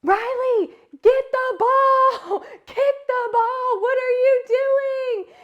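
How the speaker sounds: high, loud frantic yell from the sidelines; a mom losing it on the sideline, half laughing. Retold or imitated. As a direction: yell at the kid to get the ball. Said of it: "I am yelling on these sidelines".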